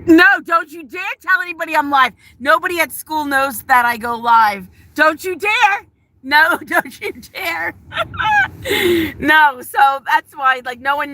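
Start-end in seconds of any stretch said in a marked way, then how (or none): 0.1-7.7 high, emphatic mock scolding; playful warning. Real, not acted. Streamer warning a coworker to keep the secret, playfully scolding. Warns visitor not to tell. 7.9-9.1 high-pitched laugh. Real, not acted. Streamer after warning a coworker, laughing high.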